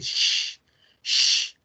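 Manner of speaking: unvoiced hushing sh, not ch; sh sound. Shown as a demonstration. Teacher contrasts sh with che. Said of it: "la che es el sonido este".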